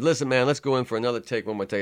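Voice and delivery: urgent loud call for another take. Retold or imitated. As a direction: shout it across the studio. Producer calling for take.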